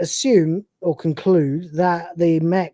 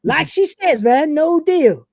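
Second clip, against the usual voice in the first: higher cocky Cat voice; cat breaking character; 9 semitones higher, 3 dB louder, slower than usual. Retold or imitated. Cat refusing the trade in his own higher voice. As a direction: snap back high and smug. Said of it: "He does it again".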